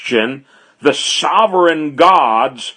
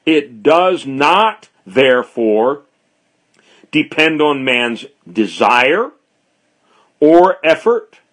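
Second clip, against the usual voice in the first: ordinary talk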